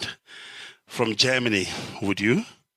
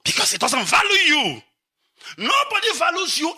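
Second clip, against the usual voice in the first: crying out; 11 semitones higher than usual. Real, not acted. Lamenting life abroad.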